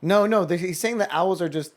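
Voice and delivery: ordinary talk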